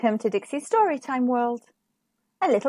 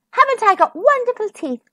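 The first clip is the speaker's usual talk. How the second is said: higher, boastful little princess; proud princess; 11 semitones higher, 3 dB louder than usual. A narrator's reading. Little princess showing off her wonderful teeth, proud and high.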